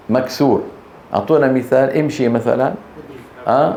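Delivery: ordinary delivery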